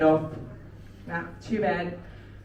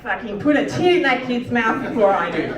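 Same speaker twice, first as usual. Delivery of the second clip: blunt outburst; 3 semitones higher, 7 dB louder than usual. Retold or imitated. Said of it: "I'm just like".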